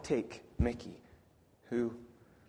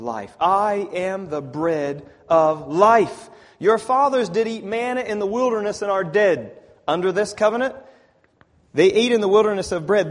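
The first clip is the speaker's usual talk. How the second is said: loud, raised preaching voice; forceful scripture reading; 5 semitones higher, 12 dB louder than usual. Acted. Preacher reading Jesus' bread of life words, forceful preaching. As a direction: proclaim the verse with rising force.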